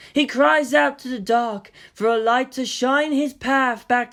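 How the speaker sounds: slow, high, otherworldly incantation; eerie mystic entity. Acted. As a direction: intone it slowly like an ancient power praying.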